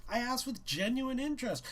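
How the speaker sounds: ordinary delivery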